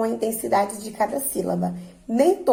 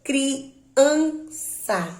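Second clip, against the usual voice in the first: criança as cri, an, ça, raised in pitch; syllable by syllable; 5 semitones higher than usual. Shown as a demonstration. Teacher asking for the strongest syllable, criança split into syllables. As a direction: separate criança into higher, measured syllables. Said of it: "Qual dessas sílabas são a mais forte?".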